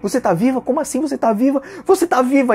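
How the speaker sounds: tearful disbelief. Retold or imitated. Henry, in tears, sees Marta alive.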